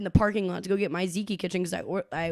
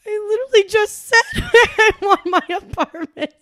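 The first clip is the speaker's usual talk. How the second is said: laughing exasperation; 11 semitones higher, 7 dB louder than usual. Retold or imitated. Exasperated retold reply, laughing.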